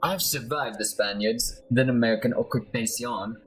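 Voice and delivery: put-on theatrical Spanish accent with swooping pitch; amateur Spanish-accented hustler; Eduardo Carnella. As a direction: sell yourself as a protector, Spanish-accented. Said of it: "my horrible accent".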